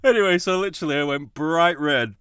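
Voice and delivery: loud, high laughter through words; laughing hard. Real, not acted. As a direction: crack up while telling it. Laughing at own story.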